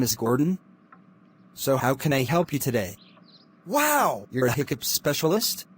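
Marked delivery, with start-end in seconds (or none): 3.7-4.3 surprised. Acted. Meets hiccup specialist.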